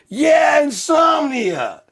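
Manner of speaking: high, stretched-out shriek; all-out comic yelling; screamed rant. Acted. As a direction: scream the answer slowly.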